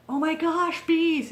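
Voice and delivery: high mock yell of alarm; re-enacted yell to a husband; retold yell. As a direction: call out a panicked warning, half-strength. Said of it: "So I yell to my husband".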